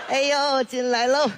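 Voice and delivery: ordinary delivery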